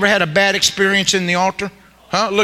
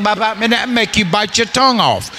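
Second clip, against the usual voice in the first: ordinary delivery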